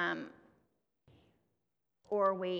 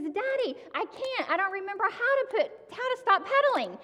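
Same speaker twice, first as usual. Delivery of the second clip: put-on much higher, louder scared little girl's voice; panicked little girl; 12 semitones higher, 5 dB louder than usual. Daughter crying out to her dad while her bike speeds downhill, panicky and high. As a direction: squeal it like a child losing control of a bike. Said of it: "And she says".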